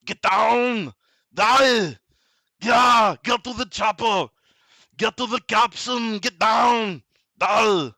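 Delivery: loud action-hero impression, punchy bursts; Arnold. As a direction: shout short commands like an action-movie star. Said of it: "uh, the Arnold".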